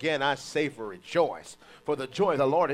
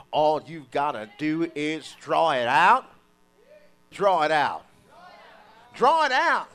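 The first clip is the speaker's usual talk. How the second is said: slow, loud preaching, hitting "draw"; 9 dB louder, slower than usual. Acted. Preacher urging the church to draw it out, loud. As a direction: push the call to draw it out, louder each time.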